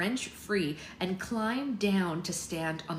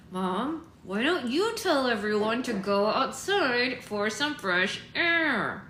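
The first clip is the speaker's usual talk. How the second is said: higher, stretched-out forced suggestion; strained false cheer; 4 semitones higher, 4 dB louder, slower than usual. A narrator's reading. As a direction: suggest it with strained, emphatic lightness. Poppy strained, urging her mother.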